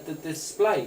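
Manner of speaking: usual speaking voice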